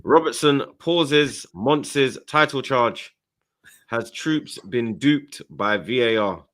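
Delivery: slow, dramatic headline voice; news headline read. Acted.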